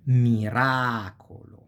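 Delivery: miracolo slowly, ra drawn out; stress on third-to-last. Shown as a demonstration. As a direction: stretch mi-RAA-colo. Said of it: "falls on the third to last syllable".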